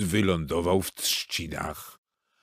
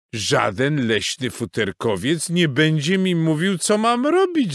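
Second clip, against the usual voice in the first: rising, high-pitched indignation; huffy goose; 6 semitones higher, 3 dB louder than usual. A narrator's reading. Goose Gęgula to the beavers, huffy. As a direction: bristle as an affronted goose. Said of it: "zaperzyła się gęś Gęgula".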